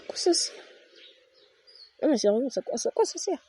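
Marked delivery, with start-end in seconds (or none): 2.0-3.4 squeaky, much higher, incredulous question; real bafflement at a useless item; exasperated disbelief. Real, not acted.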